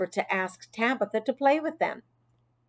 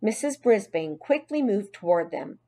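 ordinary delivery

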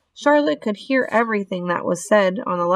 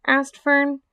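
ordinary delivery

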